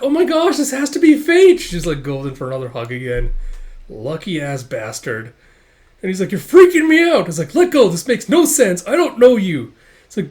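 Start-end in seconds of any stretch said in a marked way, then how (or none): 0.0-1.6 very high, loud squeal of delight; ecstatic gushing. Acted. Smitten girl lunging for another hug, ecstatic gushing. 6.3-7.3 high, loud panicky protest; freaked out. Acted. Boy grabbed in an unwanted hug, freaked out. 7.5-9.7 loud, high-pitched objection; alarmed protest. Acted. Boy struggling out of a stranger's hug, alarmed protest.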